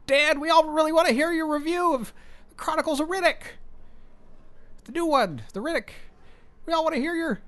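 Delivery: put-on high kid voice pestering dad; whiny high child pleading with dad; child's voice. Voicing his child asking him.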